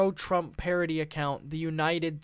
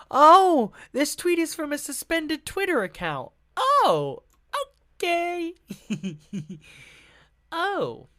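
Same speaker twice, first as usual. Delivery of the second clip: a much higher, loud surprised oh; 9 semitones higher, 6 dB louder than usual. Real, not acted. Vlogger reacts with surprise to a suspended Twitter account. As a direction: caught off guard by what he finds.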